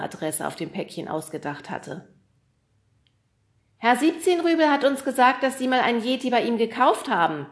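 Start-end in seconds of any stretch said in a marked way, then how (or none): 3.8-7.5 high, loud call upward. A narrator's reading. Fred calling up to Kriegelstein, high and loud. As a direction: shout it up to the roof. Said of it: "rief er nach oben".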